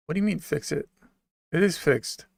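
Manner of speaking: ordinary talk, nothing marked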